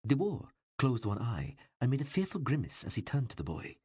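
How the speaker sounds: ordinary delivery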